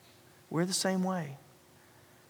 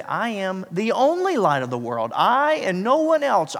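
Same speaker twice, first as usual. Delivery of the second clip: only drawn out, then louder I and no one else; stress on only; 4 semitones higher, 4 dB louder than usual. Shown as a demonstration. Pastor showing Jesus means the only light, stress on only. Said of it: "He used a form of the language to say".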